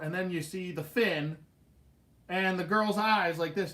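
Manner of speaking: ordinary talk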